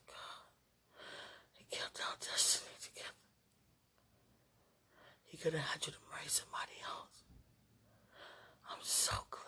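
hushed intimate whisper. Real, not acted. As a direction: whisper it close to the mic.

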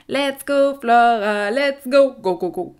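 loud peppy cheering; cheerleader. Acted. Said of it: "pas des encouragements de cheerleader".

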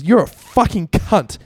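hammed-up furious slur; mock angry insult. Martin storming in at David, mock angry.

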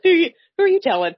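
high, loud, quick who-are-you-telling; amused disbelief. Real, not acted. To her co-host, a high excited who-are-you-telling. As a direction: squeal it in amused recognition. Co-host jokes about loneliness.

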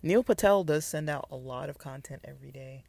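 ordinary talk, nothing marked

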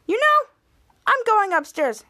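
high-pitched indignant announcement; acted huffy exit. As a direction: storm off in a huff. Sister storms off from quarrel.

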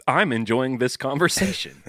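mechanical, over-even pleasantry; robot voice. Pretend. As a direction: play along as a machine making small talk. Joins in the robot bit.

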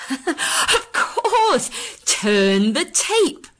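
high, loud excited cry; childish excitement. Acted. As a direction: burst out in sudden glee. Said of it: "with a childish cry of excitement".

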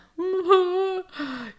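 put-on high-pitched tearful toddler whimper; mock child whimper. Sad granddaughter answering grandma, mock whimper.

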